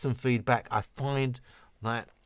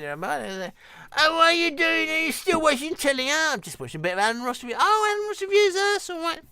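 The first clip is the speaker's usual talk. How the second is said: nagging wife; 5 dB louder than usual. Retold or imitated. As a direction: play the nagging wife in falsetto. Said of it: "is the wife nagging you".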